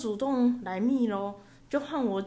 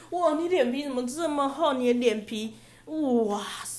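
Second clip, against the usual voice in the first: incredulous scorn; 5 semitones higher, 4 dB louder than usual. Real, not acted. Mocking the scammer.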